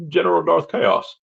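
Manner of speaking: lifted, hammed-up welcome to a general; mock grand greeting. Streamer hails a viewer as a general, hammed-up. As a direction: greet him like a movie general, high and playful.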